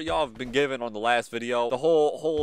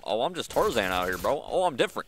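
ordinary talk

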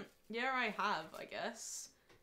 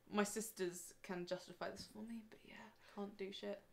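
whisper; 7 dB quieter than usual. Real, not acted. Aside muttered to camera.

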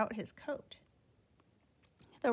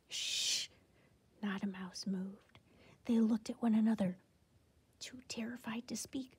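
breathy whispered narration; hushed whisper; 4 dB quieter than usual. A narrator's reading. Narrating the terrified silent mice, whispering. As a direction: drop to a frightened whisper. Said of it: "whispered narration of silence".

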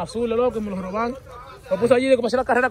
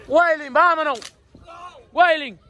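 calling out; 8 semitones higher, much slower than usual. Real, not acted. Calling his friend to leave the store, high shout. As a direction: shout the name to get him moving.

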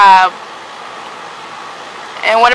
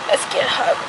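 whispered; 9 dB quieter, faster than usual. Real, not acted. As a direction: breathe it gently. Coaxing toddler into a hug.

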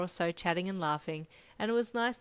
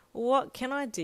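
exasperated; 6 semitones higher, 4 dB louder than usual. A narrator's reading.

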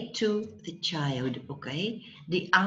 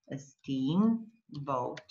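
"a steamboat" drawn out slowly; slow dictation; 5 semitones lower, 4 dB quieter, much slower than usual. Shown as a demonstration. Teacher writes word for student, slow dictation. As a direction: stretch out "steamboat" as if writing it. Slow repeat while writing.

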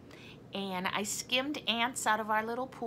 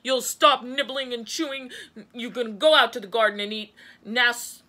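forceful scolding at volume; stern shout; 2 semitones higher, 8 dB louder than usual. A narrator's reading. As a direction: bellow out the new rules. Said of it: "shouted Gus".

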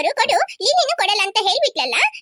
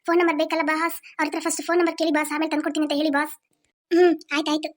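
henchman voice; 6 semitones lower than usual. Acted. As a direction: talk low to your boss like a dim henchman. Kidnapper henchman talks to boss. (Boss ಜೊತೆ ಮಾತಾಡುವ ಚೇಲಾ, ತಗ್ಗಿದ ಧ್ವನಿ)

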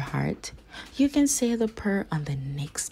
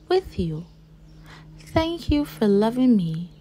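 put-on heartfelt child's prayer; 5 semitones higher than usual. Second children's prayer read aloud.

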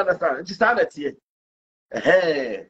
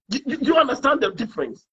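ordinary delivery

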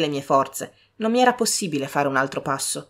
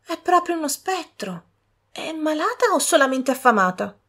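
higher-voiced pitying sister; 10 semitones higher than usual. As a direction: gently pity the stranger.

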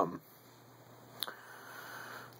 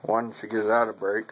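talking around pipe stem; pipe in mouth. Real, not acted. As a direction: lips clenched on pipe. Seen 2: Pipe stem held in mouth throughout the words; absent in references.

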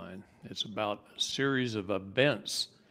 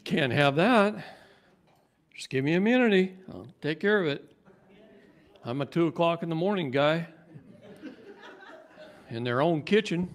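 raised, louder retold insistence; high-voiced insistence; 6 semitones higher, 6 dB louder than usual. Man retelling his plea for immunity, high-voiced. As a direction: press the case in a lifted voice. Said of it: "My voice gets really high".

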